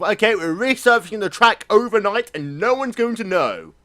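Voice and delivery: high, loud mock announcement of a scheme; mock schemer. Joke Red Bull plan after qualifying, high and loud. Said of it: "the joke, of course".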